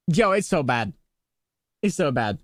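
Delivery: ordinary delivery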